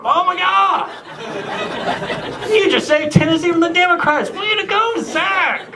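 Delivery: very high excited squeal; cheering crowd. Retold or imitated. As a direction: cheer the hero on. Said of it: "cheering the hero".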